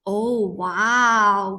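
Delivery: theatrical wow. Pretend. Amazed at bears not eating.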